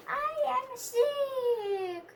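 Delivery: slow, high, drawn out with relief; delighted. Acted. Gerald tells Doctor Cat slowly and happily. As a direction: savor the news happily.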